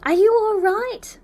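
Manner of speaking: high, loud, slow worried cry; anxious call. A narrator's reading. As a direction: plead to know he's safe. Students anxiously calling.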